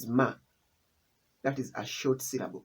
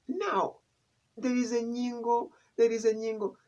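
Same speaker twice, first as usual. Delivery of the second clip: high, mild mock questioning voice; a hint of a puzzled student; imitated questioner; 8 semitones higher than usual. A student asking the teacher, lightly voiced. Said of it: "he was like".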